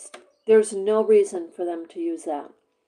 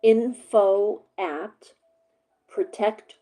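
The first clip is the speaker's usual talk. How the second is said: spelling out an email slowly; slow dictation. Shown as a demonstration. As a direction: dictate each part slowly. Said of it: "I'm gonna give you the email address".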